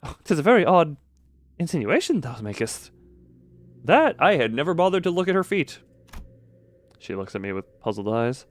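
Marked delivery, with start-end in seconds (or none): 0.0-5.8 put-on high, grand, old-fashioned knightly speech; lofty knight voice. Streamer voicing Don Quixote's lines to Squire in knightly voice. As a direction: play the proud knight, chest out.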